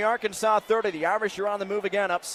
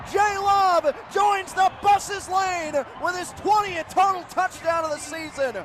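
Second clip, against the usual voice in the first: high-pitched, building play-by-play shout; roaring, triumphant touchdown commentary; ecstatic breakaway call; 12 semitones higher, 6 dB louder than usual. Real, not acted. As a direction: chase the runner downfield with your voice.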